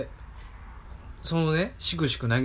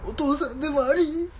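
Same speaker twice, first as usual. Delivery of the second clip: 泣き笑いで; 7 dB louder than usual. Retold or imitated. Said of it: "泣きながら".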